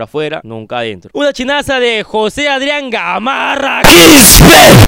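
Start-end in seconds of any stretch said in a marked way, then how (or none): 2.9-4.9 explosive, drawn-out roar of the surname; odd accented surname shout. Pretend. Host announcing donor Juan José, surname roared in an odd accent. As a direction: blast the surname out absurdly loud. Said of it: "¿Por qué ese acento?".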